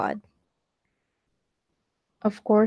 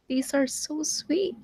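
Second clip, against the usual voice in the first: shy sweet line, higher; 6 semitones higher, 5 dB quieter than usual. Acted. Blushing character line.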